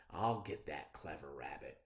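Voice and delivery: father quietly vowing to catch the rabbit; scheming mutter. Acted. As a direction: plot revenge under your breath. Father plotting revenge.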